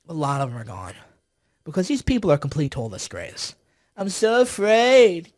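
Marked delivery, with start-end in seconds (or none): 3.9-5.4 loud, drawn-out mocking whine of fear. Commentator mimicking frightened NSC staffers, mock fearful. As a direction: drag it out in exaggerated pretend fright.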